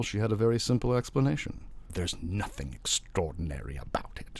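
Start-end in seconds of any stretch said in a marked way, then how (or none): 1.9-4.4 hushed, breathy whisper. A narrator's reading. Spiritualist wife whispering to her husband. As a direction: whisper it knowingly. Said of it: "she said".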